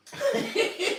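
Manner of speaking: squealing high laugh; high laugh burst. Real, not acted. Tom retching outside.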